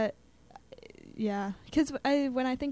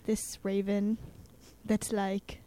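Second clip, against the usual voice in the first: ordinary delivery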